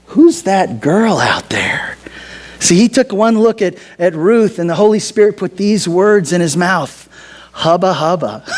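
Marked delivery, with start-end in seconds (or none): 0.1-2.0 slow, low, half-whispered wonder; smitten whisper. Acted. Boaz asking his workers about the stranger, smitten whisper. Boaz spots Ruth, acted. 7.5-8.5 low, playful appreciative murmur; hammed-up admiration. Preacher voicing Boaz after one look at Ruth, hammed-up admiration.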